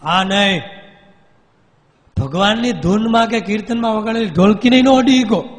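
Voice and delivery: possessed man yelling no; retold shout. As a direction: cry out in refusal. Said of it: "રાડ પાડી".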